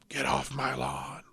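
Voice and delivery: put-on grumpy old man voice; Clint Eastwood impression. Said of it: "I'm not that good at impersonation".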